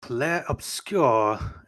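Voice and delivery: put-on drawn-out, deliberately terrible French accent; bad French accent. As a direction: stretch the name out in a hammed-up French accent. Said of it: "to do a really bad French accent again".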